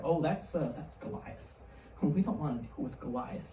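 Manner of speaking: low, very soft hesitant reply; timid. Acted. Soldiers explaining Goliath to David, timid hushed voice. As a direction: mumble it as if avoiding the giant's notice.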